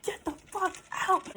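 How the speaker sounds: yelling. Retold or imitated. Boyfriend ordering the girlfriend out, yelling. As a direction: bark him out of the house. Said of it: "he just starts yelling".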